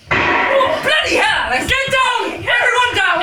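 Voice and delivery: high frantic yells; panicked shouting. Acted. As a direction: shout the warning. Sudden attack, yelling.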